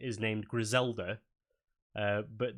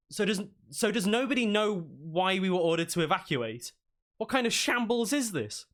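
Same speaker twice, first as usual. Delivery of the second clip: higher, exasperated questioning; indignant townsman; 9 semitones higher, 3 dB louder than usual. Acted. Townsman complains to the crowd, high and indignant. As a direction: demand to know what's going on. GM voices NPC demanding answers.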